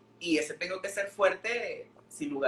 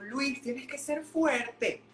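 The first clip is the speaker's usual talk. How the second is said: a lightly voiced grandma, pitched higher and slower; grandmother insisting; 7 semitones higher, 3 dB louder, slower than usual. Grandson retells how his grandmother told him to be strong, a hint of an insistent grandma. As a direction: push him like a stern but loving grandmother, mildly. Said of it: "que ella un día me dijo".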